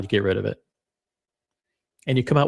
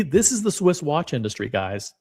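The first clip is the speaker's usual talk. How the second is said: ordinary talk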